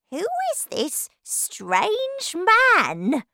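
high, slow, drawn-out question; loud, high storybook outcry; indignant cry. A narrator's reading. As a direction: cry out, scandalised by a stranger. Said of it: "cried Grandma Josephine".

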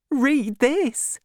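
high, eager and happy; happily. A narrator's reading. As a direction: hand it over with joy. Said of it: "she said happily".

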